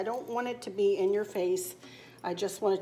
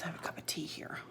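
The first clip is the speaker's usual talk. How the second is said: hushed unvoiced whisper, much softer; 3 semitones lower, 13 dB quieter, faster than usual. Real, not acted. Vlogger telling viewers about her tea, whispering. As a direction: murmur it under your breath.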